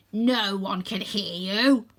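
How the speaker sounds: slow, lilting villain taunt; slow sing-song villain mockery. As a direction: sing it to mock her. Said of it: "in a sing-song voice".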